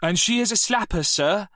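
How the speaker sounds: mock the class like a smug fifteen-year-old; cheeky schoolboy.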